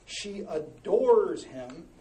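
usual speaking voice

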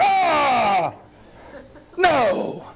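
high, loud yelp of horror; disgusted cry. Retold or imitated. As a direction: shriek like you just tasted pig filth. Prodigal wipes mouth, pig mess.

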